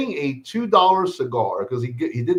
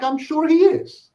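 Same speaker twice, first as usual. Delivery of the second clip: high mock agreement, drawn out; sarcastic; 13 semitones higher, 4 dB louder, slower than usual. Sarcastically agreeing with the kid's boast. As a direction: say it with heavy sarcasm. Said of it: "I'm like".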